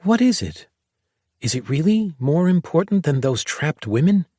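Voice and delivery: put-on high, puzzled young girl's voice; a kid pressing for answers, bewildered; confused girl. A narrator's reading. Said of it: "She shook her head in confusion".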